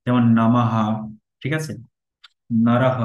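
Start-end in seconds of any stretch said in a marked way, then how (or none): 0.3-0.8 নামাহা ending on a breathed "ha"; visarga as h. Shown as a demonstration. Teacher showing the visarga to learners, নামাহা with final h. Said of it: "হয়ের মতো করে উচ্চারণ". 2.6-3.0 নরহ ending on a breathed "ha"; visarga as h. Shown as a demonstration. Teacher showing the visarga to learners, নরহ with final h. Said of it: "হয়ের মতো করে উচ্চারণ".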